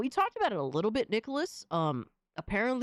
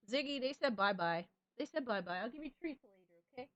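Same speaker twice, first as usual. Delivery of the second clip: quieter cooing to the cat; soft pet talk; 2 semitones higher, 6 dB quieter, faster than usual. Real, not acted. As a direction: promise the cat treats gently. Softly talking to her cat.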